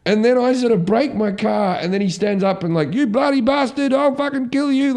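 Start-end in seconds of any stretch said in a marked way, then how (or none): 2.9-4.9 put-on high, shouted drunk threats; raging drunk bloke yelling; angry drunk yell. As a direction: bellow it like a furious drunk. Drunk man yelling after crash.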